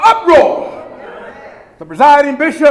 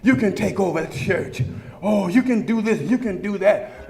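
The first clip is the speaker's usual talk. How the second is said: much lower and softer, mock whisper; tempting voice in the young pastor's ear; whispering tempter; 8 semitones lower, 9 dB quieter than usual. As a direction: murmur it like a sly voice in his ear. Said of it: "got whispers in his ear saying".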